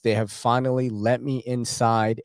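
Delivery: normal talking voice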